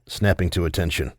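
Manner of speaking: ordinary delivery, nothing marked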